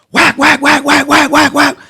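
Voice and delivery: loud, high imitated gunfire; gunshot sounds. Recalling the shots in the pool, loud imitated gunfire. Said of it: "all I hear".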